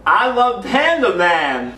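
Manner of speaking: tongue held, words mangled; holding own tongue. Pretend. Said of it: "hold your tongue and say".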